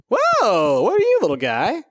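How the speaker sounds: high, loud, astonished greeting; real surprise. Real, not acted. Player meeting a strange little dwarf, surprised. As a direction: exclaim at an unexpected find. Meets an unknown creature.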